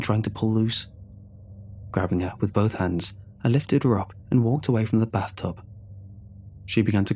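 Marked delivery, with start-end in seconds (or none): none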